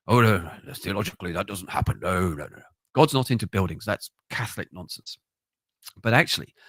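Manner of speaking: sniffy, know-it-all brush-off; lightly mock the clever theologian; dismissive mockery. Preacher mimicking sceptical Christians, dismissive.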